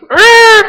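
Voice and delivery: very high, loud screech; donkey skidding noise. Acted. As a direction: imitate a donkey screeching to a halt. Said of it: "And the donkey goes".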